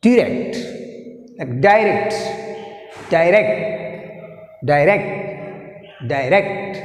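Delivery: "direct" with RECT stressed; stress on second syllable. Shown as a demonstration. Teacher drilling stress for students, stressed RECT in direct. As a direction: lean on RECT in each "direct". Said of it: "rect is second syllable".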